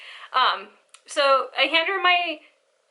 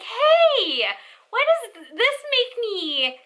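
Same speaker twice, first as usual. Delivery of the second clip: high, indignant, slowing down; indignant protest; 10 semitones higher, slower than usual. Retold or imitated. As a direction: protest as an outraged kid, hammed-up.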